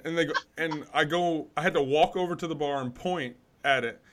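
ordinary talk

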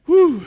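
high, loud exhale of effort; winded whew. Real, not acted. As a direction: blow out hard, winded. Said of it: "how out of shape I was".

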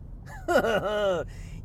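wheezy, near-crying laugh. Real, not acted. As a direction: laugh until it almost squeaks. Laugh that sounds almost crying.

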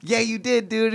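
high-pitched mock argument; indignant contradiction. Teen contradicting his friend on the phone, high-pitched retold indignation. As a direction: throw it back at him, pitch jumping up.

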